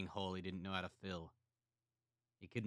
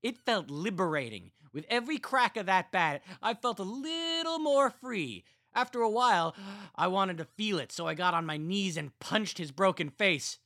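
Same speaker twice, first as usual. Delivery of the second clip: manic relish; 13 semitones higher, 14 dB louder than usual. A narrator's reading. Carl gloating to his siblings about the murder, manic. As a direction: savour every word of it.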